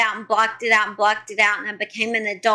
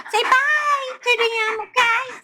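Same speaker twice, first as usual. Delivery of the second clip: high dog-talk voice; 13 semitones higher, slower than usual. Real, not acted. Mother cooing to her dog Simon, high and drawn out. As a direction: coo at the pet in a high sing-song.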